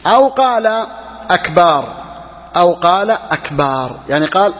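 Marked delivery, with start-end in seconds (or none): 1.3-1.9 أكبار ending in a stretched "-baar"; long final aa. Shown as a demonstration. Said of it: "لأن أكبار جمع كبر". 3.1-4.0 أكبار with "-baar" drawn out, lower; long final aa. Shown as a demonstration. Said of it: "لأن أكبار جمع كبر".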